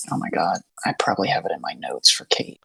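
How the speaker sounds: hushed whisper to herself. Real, not acted. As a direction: mutter it under your breath. Whispering to herself while searching notes.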